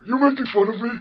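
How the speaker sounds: put-on offended, high old-man voice; John Henry. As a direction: ask it as a wounded cartoon old man. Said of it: "you have the generic old man voice".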